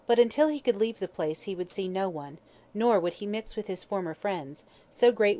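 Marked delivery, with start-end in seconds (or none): none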